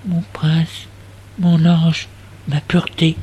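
breathless, unvoiced whisper of adoration; tender whisper. Narrator whispering his longing behind the young man, whispered. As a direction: breathe the words as if he might hear. Creeping close to the beloved.

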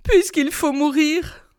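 mildly tearful doomed wife; tearful pleading. A narrator's reading. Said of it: "les yeux baignés de larmes".

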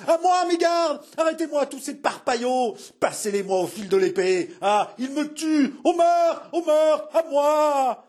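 very high, strained panicked screaming; hysterical cry for help. A narrator's reading. King calling his guards against murderers, frantic screaming. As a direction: scream murder at the top of your range.

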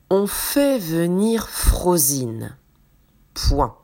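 slow, clear dictation voice; dictating. Acted. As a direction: read it out slowly word by word. Dictation read to the class.

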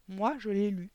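usual speaking voice

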